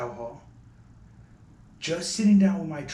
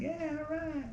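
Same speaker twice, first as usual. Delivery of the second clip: very high, softer, drawn-out impression; Moody's high tone; 6 dB quieter than usual. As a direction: draw it out way up high. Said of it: "And a very high tone".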